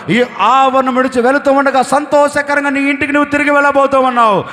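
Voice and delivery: building, loud blessing climax; preaching at full force. Acted. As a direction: proclaim it to the whole hall.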